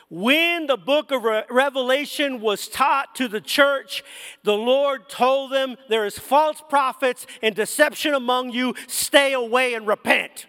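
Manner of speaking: preaching at full force. Acted. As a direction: preach it out to the church with fire. Preacher builds to warning climax.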